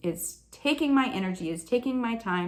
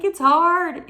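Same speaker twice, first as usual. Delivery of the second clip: high, loud mock whine; whining child; 9 semitones higher, 11 dB louder than usual. Believer whining to God like a child, exaggerated. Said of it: "I'm this child that's, like, whining".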